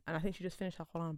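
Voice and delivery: ordinary talk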